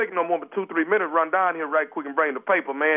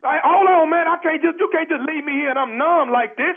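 panicked shout; 8 semitones higher than usual. Acted. Numb man begging neighbor not to leave, panicked shout. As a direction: cry out not to be abandoned.

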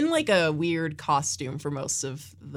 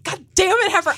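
exasperated; 13 semitones higher, 7 dB louder than usual. Retold or imitated. Character scolding Heifer.

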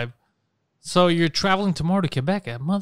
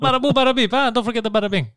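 higher, teasing fake-Hindi syllables; mock a friend's gibberish Hindi; mock Hindi imitation; 6 semitones higher than usual. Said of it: "why that was my imitation".

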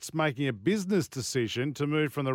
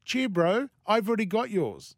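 hammed-up Kiwi accent, pitched up; New Zealand accent; 6 semitones higher than usual. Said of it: "accent was that?".

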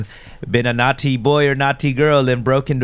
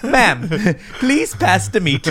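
high, slow, overly polite request; prim polite voice; 7 semitones higher, much slower than usual. Pretend. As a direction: say it like a prissy dinner guest. Mocking the wholesome dinner.

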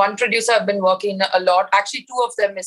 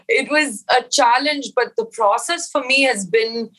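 ordinary talk

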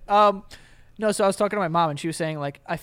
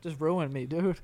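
ordinary talk

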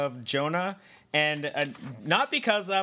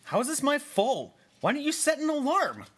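annoyed; 8 semitones higher than usual. Acted. Quarrel with girlfriend.

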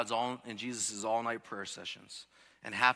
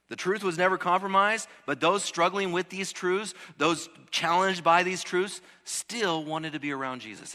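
higher, louder preaching climb; full-force preaching; 8 semitones higher, 9 dB louder than usual. Acted. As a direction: preach it out with rising fire. Preacher building to a peak.

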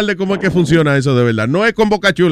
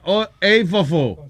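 slow dictation; 4 dB quieter, much slower than usual. Shown as a demonstration. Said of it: "Ponga, escriba".

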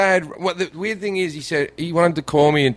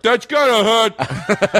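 put-on slow, higher drunken slur; 5 semitones higher, much slower than usual. Drunk man watching his hand swell, slurred and slow. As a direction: play it drunk, dragging the words out. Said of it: "I was so drunk that it was like".

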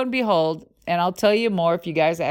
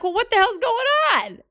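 incredulous; 13 semitones higher than usual. Retold or imitated. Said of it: "And I'm like".

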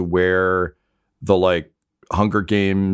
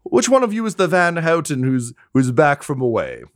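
flamboyant aristocrat; 11 semitones higher than usual. Acted. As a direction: sweep in like a preening dandy. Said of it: "he says".

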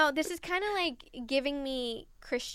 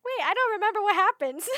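normal talking voice

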